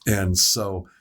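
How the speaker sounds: normal talking voice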